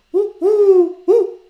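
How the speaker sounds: very high, loud cooing woo; wood pigeon coo. Shown as a demonstration. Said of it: "Wood pigeons go".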